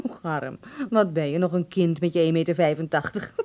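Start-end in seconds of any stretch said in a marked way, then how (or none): 0.1-0.6 amused, indulgent laugh; laughing at her son; laughing fondly. Acted. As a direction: chuckle through his name.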